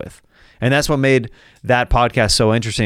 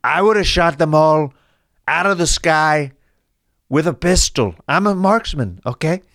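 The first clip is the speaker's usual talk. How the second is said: higher, boastful presidential caricature; Donald Trump; 6 semitones higher than usual. Acted. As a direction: deny it all with emphatic, spaced-out phrases. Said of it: "Chinese balloons during Trump's presidency".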